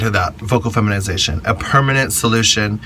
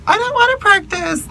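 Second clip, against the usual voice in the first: very high-pitched mock complaint; exaggerated high refusal; mock protest. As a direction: protest in a squeaky put-on voice. Acted protest to advice to practice.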